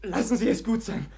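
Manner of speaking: urgent plea. Acted. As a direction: call out sharply to hold him back. Stopping Gavrila from hitting.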